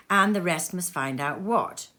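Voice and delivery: usual speaking voice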